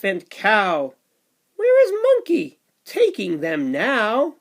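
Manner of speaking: put-on lively read-aloud for little kids; sing-song storybook rhythm; bouncy picture book narration; reading to children.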